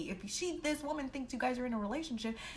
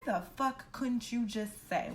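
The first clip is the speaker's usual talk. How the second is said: usual speaking voice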